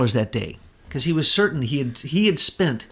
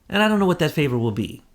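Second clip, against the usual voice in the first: ordinary talk, nothing marked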